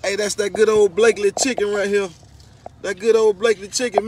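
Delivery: loud proud call. Real, not acted. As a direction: announce it to the room. Calling out praise to the room.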